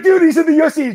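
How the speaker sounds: high, loud disbelieving yell; almost shouting it in disbelief; exasperated shout. Retold or imitated. Telling his clueless buddy the obvious, loud and high. Telling friend who Jared is.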